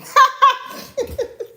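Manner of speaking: sudden squeal of laughter; real high laugh; high burst of laughter. Real, not acted. Broken blender mishap.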